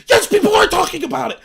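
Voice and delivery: high, loud snapping retort; mock enraged retort. Friend snapping at host, mock angry retort. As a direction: snap back indignantly. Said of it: "Immediately got enraged".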